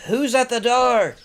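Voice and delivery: slow, high holler down the stairs; calling from upstairs. Retold or imitated. Someone upstairs asking about a visitor, drawn-out call. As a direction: imitate someone yelling from another floor. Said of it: "I hear somebody upstairs say".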